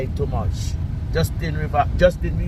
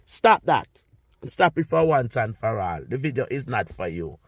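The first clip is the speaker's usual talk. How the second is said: ordinary talk, nothing marked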